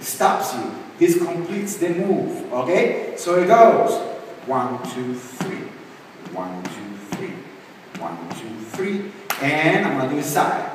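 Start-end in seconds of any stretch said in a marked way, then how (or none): 4.5-9.1 low, hushed dance counts; quiet step count. Shown as a demonstration. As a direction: count the steps softly under your breath. Counting steps while demonstrating the move.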